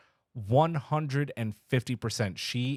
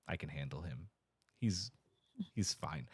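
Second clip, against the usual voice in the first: much lower and much softer, understated; a hint of a low, hushed Kirk; mock Kirk; 5 semitones lower, 11 dB quieter than usual. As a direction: drop it low and soft, half under the breath. Retelling Kirk's line in the movie.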